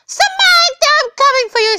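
high, mock-heroic call; dramatic calling out. Playful rescuer calling to hostage Samantha, high dramatic call.

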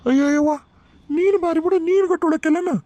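high-pitched, protesting whine; indignant retort. Acted. Son answering his scolding mother, indignant.

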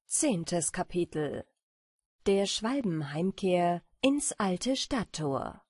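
ordinary delivery, nothing marked

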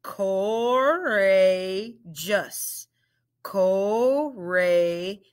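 "Courageous" said slowly, syllable by syllable; segmented "Courageous"; slow, split into syllables. Shown as a demonstration. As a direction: stretch out "Courageous" part by part. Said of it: "Let's blend it".

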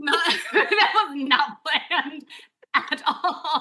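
slow words broken by real laughter; laughing. Real, not acted. Guest after almost doing a spit take, laughing protest. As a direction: protest through giggles.

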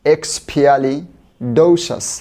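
every syllable hugely stretched; very slow. Shown as a demonstration. Teacher leading students to repeat, very slow. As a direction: drag each sound out for repeating. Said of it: "আপনারা আমার সাথে repeat করবেন".

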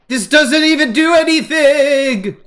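high, drawn-out exasperated whine; frustrated whine. Real, not acted. Stuck on crafting, frustrated.

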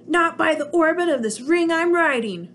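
squeaky alien standing firm; little alien shouting his refusal; Gork crying out. Acted. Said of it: "cried Gork".

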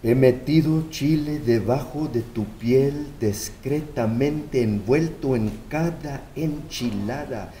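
soft, low poetic Spanish; mother speaking softly to her son in Spanish; tender Spanish recital. Acted. Spanish echo of mother's letter.

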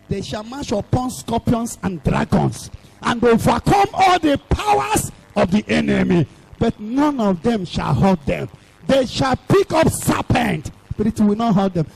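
emphatic scripture declamation, rising in bursts; full-force preaching. Acted. Preacher quoting Luke's promise to believers, forceful preaching. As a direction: proclaim each promise with force.

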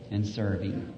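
ordinary delivery

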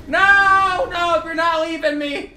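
high, loud cry of protest; protesting shout. Real, not acted. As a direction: shout after a fleeing opponent. Opponent escaping in the game.

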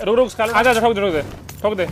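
shouting to the squad to attack; urgent shout. Real, not acted. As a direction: bark the orders in the heat of the fight. Shouting orders during a fight.

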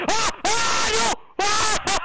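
high panicked screams; panicked screaming. Real, not acted. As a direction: scream as you're about to die. Being killed in game.

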